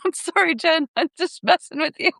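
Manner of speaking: high, giggly confession; laughing apology. Retold or imitated. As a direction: admit the prank while laughing. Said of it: "I just laughed and said".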